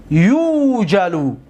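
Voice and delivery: یوجلو said "yuujalu", the first "u" stretched long; passive-voice form. Shown as a demonstration. Teacher demonstrates the passive, یوجلو pronounced with a long "yuu". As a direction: slowly draw out the opening of یوجلو, then fall.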